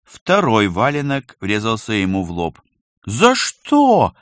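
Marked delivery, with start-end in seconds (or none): 3.0-4.1 high, loud indignant protest; indignant outcry. A narrator's reading. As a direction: cry out in wounded outrage. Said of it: "возмутился Колька". (возмущённый громкий возглас)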